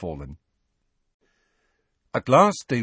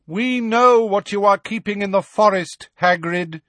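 high, loud, carrying warning; calling after; 11 semitones higher, 4 dB louder than usual. A narrator's reading. Magorian calling after departing Hagrid, raised voice. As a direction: call it out across a distance. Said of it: "Megorian called after them".

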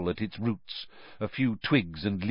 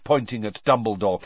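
usual speaking voice

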